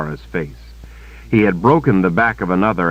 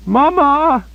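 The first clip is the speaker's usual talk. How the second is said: piercing high terrified shriek; loud child-like scream of terror; 5 dB louder than usual. A narrator's reading. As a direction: shriek for her mother like a frightened child. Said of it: "she shrieked".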